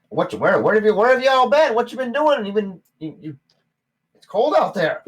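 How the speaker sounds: high, loud gnome voice; gnome barkeep. Acted. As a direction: play the startled little barkeep.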